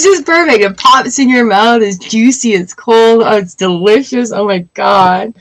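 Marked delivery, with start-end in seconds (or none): none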